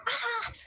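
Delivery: small high-pitched mock scream, kept soft; imitated scream. Mother retelling first push, soft mock scream.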